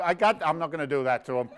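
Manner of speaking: ordinary delivery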